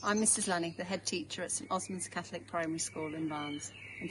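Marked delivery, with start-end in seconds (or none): none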